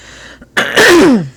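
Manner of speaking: loud, high throat clear. Real, not acted. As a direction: clear your throat hard. Hoarse host clears throat again.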